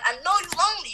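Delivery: lightly teasing, mid pitch; mock sympathy. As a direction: say it knowingly. First take plainer.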